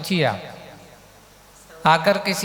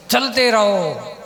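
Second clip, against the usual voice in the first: higher, slow, drawn-out urging; long, raised cry of encouragement; rallying call; 4 semitones higher, 4 dB louder, slower than usual. Retold or imitated. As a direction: call walkers onward, stretching the words. Urging pilgrims to keep walking.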